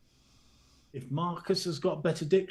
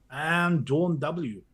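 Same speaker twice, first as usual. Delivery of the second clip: ordinary talk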